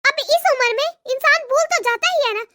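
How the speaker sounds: usual speaking voice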